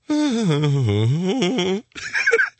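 high strained wordless noise; mimed gut-sucking. Acted. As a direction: sound out holding your stomach in.

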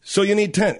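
usual speaking voice

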